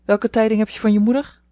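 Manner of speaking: licht snibbige oude dame, wat luider; snibbig. A narrator's reading. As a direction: kortaf en vinnig gevraagd, licht aangezet. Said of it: "vroeg de oude dame snibbig". (oude dame vraagt Jo snibbig)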